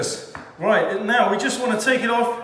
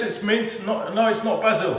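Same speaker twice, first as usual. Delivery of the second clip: ordinary talk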